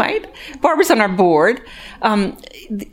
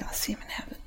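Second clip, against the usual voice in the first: unvoiced, even softer; whispered repetition; 17 dB quieter, faster than usual. Real, not acted. Repeating her hope to the church, faint whisper. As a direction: let it fade to a breath.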